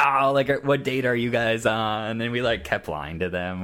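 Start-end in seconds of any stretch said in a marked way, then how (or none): 0.0-2.2 put-on louder, rowdy drunk-dude voice; drunk guys in line. Drunk guy in line teasing the couple, loud and rowdy. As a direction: ham up a loud tipsy guy calling out. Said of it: "they're like".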